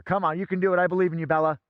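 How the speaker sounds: ordinary talk, nothing marked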